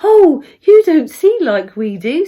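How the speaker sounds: lively storybook fairy, amused; grinning fairy. A narrator's reading. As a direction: say it through a grin, voice lifted. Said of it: "said the fairy with a grin".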